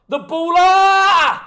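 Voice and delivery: long, high, loud celebratory yell; goal scream. Real, not acted. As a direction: stretch the name into a huge goal shout. Goal from the penalty.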